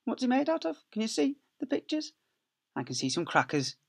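usual speaking voice

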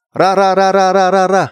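high, loud rapid chant of ra; excited ra ra ra. Shown as a demonstration. Hurrying everyone out, an excited high loud chant. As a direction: urge everyone to move, chanting fast and loud. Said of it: "If you're really excited to go".

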